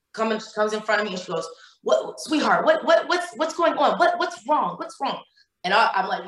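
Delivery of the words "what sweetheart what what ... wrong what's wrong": high, urgent concern, repeating what; a stranger rushing to help, pitched up high; concerned woman pleading to know what's wrong; worried stranger asking. Retold or imitated.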